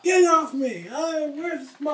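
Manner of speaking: high, loud, frantic yell; panicked old man. Retold or imitated. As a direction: fight them off like a scared old man. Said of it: "He's like".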